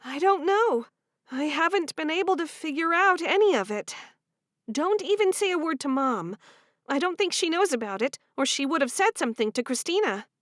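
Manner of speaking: lightly voiced anxious sister; young woman voice. Female character voice in story.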